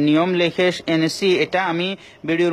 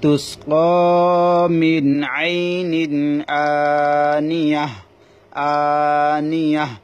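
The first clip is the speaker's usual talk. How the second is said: slow, drawn-out melodic Quran chant; slow chanted Quranic Arabic for a tajweed class; Quran recitation; 3 dB louder, much slower than usual. Shown as a demonstration. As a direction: recite it slowly with tajweed and stop on the last word. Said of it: "যেমন আমি বলে দিচ্ছি".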